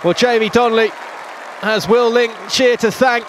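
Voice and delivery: ordinary delivery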